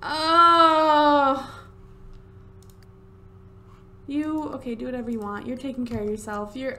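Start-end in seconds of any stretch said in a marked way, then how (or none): 0.0-1.6 loud, long "oh" of dismay; drawn-out, upset cry; dismayed groan. Real, not acted. Upset over the dying pet.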